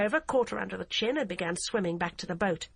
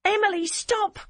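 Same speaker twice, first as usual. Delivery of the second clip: high, loud screamed plea; desperate shout; 13 semitones higher, 6 dB louder than usual. A narrator's reading. Barbara screams for the DCI to stop, high loud.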